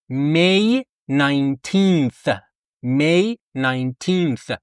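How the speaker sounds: very slow careful date; teacher modelling very slowly; slow date model. Shown as a demonstration. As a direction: stretch out May nineteenth clearly.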